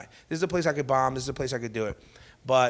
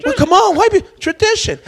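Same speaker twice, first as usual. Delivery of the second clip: very high, loud hammed-up objection; squeaky comic outcry; mock pleading protest; 10 dB louder than usual. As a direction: plead like an outraged parent. Acting protesting parent in his bit.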